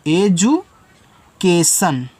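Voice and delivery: drawn-out, syllable by syllable; slow demo word. Shown as a demonstration. Teacher modelling a word for students, very slowly. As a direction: stretch out education slowly so students hear every syllable. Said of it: "तो यहाँ पे ए".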